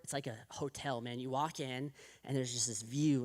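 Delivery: normal talking voice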